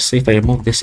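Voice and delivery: ordinary talk, nothing marked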